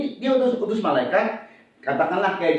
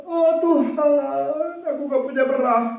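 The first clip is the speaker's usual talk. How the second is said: mock needy prayer; 8 semitones higher than usual. Preacher mimics the wrong prayer over having no rice, mock pleading. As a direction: whine to God in exaggerated want. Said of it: "bukan doa gini".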